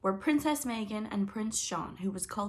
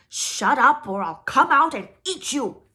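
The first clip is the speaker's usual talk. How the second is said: slow, very loud, higher troll bellow; troll's shout; 7 semitones higher, 15 dB louder, slower than usual. Acted. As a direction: bellow from the bed. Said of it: "he had shouted from his bed".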